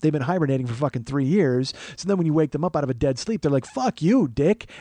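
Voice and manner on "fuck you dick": higher-pitched mock outburst; pissed-off muscles. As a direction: curse like muscles woken from a dead sleep. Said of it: "and they're pissed".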